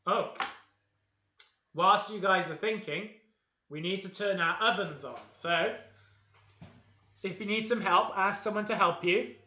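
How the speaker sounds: usual speaking voice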